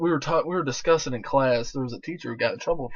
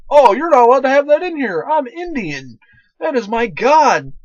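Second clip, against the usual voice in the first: loud, high-pitched protest, stretched at the end; indignant yelling; 12 semitones higher, 11 dB louder than usual. Acted. Offended student objects to a classmate's roast beef sandwich, indignant yelling. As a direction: yell an outraged objection across the room.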